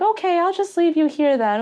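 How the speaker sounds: lightly voiced older woman backing off; older woman helper. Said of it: "she's like".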